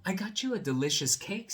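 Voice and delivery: high, loud and pleased; proud mole. Acted. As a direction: show off the gift. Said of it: "said the mole".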